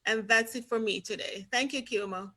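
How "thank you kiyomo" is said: usual speaking voice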